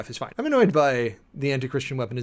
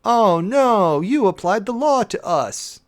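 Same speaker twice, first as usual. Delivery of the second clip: high, loud, whiny mock complaint; hammed-up whine of fake outrage; mock grievance; 7 semitones higher, 7 dB louder, much slower than usual. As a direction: mimic a self-pitying whiner, slowed on the second line. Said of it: "The sense of grievance that I hear".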